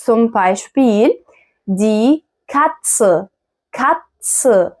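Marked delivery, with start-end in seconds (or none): 1.8-4.7 Katze split before a clear "ts". Shown as a demonstration. Teacher modelling tz for learners, crisp "ts" in Katze. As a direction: say Katze as "kat-tse", deliberately. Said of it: "التي والست مع بعض بيتنطقوا تس".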